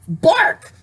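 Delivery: one sharp high yelped bark; mock dog bark. Telling the bear story, barks like the German Shepherd.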